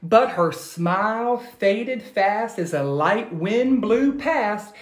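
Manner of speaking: put-on lively read-aloud to children; animated picture-book reading; storybook narration. A narrator's reading. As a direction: tell it like a bedtime story.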